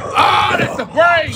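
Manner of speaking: high, loud startled outburst; shocked shout. Real, not acted. Viewer startled as the eater reaches the brain, loud shout. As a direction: blurt it out in alarm.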